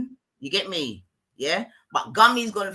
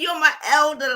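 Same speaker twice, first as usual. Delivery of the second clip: mocking quote; 8 semitones higher, 6 dB louder than usual. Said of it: "She's saying".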